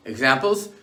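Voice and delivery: ordinary delivery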